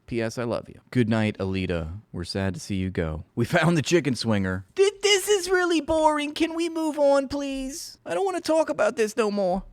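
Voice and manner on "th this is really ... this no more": whiny chatter voice. Pretend.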